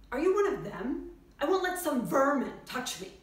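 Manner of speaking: loud, indignant quote of a patient; hostile patient. Acted. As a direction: act out a hostile patient refusing to be touched. Patient's hostile words acted out.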